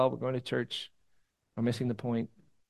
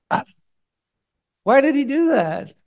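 exasperated sigh to heaven; 13 semitones higher, 10 dB louder than usual. Retold or imitated. Jesus looking up to heaven, exasperated, in a high loud cry. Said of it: "he looks up to heaven and he goes".

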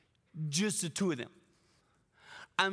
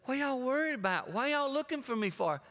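put-on higher, offhand twelve-year-old; casual kid; 7 semitones higher than usual. Jesus answering his worried parents, offhand. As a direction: shrug it off like a kid. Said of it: "And Jesus said".

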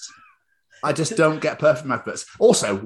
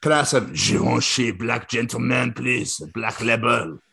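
slow mock French accent; hammed-up French perfume-advert accent. Shown as a demonstration. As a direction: purr the order in a put-on French accent. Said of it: "you have to whisper it in the accents".

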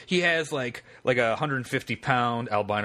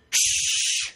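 sharp hushing shh; sudden shush. Retold or imitated. Dave suddenly hushes the narrator, a sharp shh. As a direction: cut them off with a shush.

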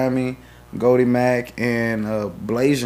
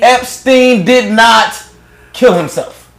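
slow, high, loud proclamation; dramatic conspiracy line; 9 semitones higher, 7 dB louder, slower than usual. Pretend. Host proclaims the catchphrase to viewers, slow and booming. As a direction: drag it out like a big reveal.

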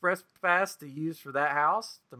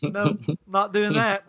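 mock flat refusal; 6 semitones higher, 4 dB louder, slower than usual. Refusing the pricey waffle.

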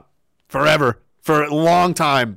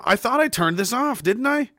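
ordinary talk, nothing marked

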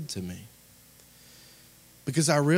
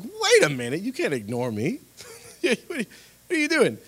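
high, loud mock indignation; offended; 9 semitones higher, 6 dB louder than usual. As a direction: protest like an affronted teenager, hammed up. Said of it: "I was kind of offended".

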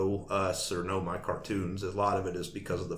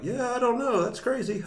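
fake innocent; 5 dB louder than usual. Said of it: "And I'm like".

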